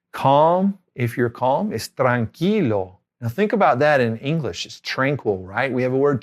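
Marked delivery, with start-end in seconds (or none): none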